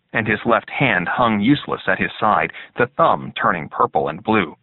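plain reading voice

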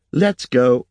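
light, encouraging voice for a little one; as if to a child. A narrator's reading. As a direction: coax him along as you would a child. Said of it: "as she would have said it to a child".

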